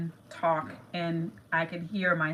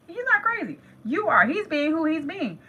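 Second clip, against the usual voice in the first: he's not crazy said much higher and fast, hammed up; sing-song high teasing; mock high voice; 8 semitones higher than usual. Shown as a demonstration. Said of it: "It's like, he's not crazy".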